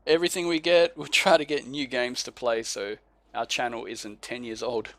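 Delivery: ordinary talk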